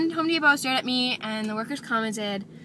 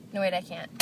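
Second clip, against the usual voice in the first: normal talking voice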